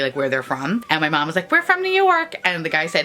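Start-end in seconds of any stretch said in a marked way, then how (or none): 1.5-2.3 high-pitched mom impersonation; imitated mom. Mom to bus tour guide, high mock-mom voice. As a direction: pitch it way up as a chirping mother.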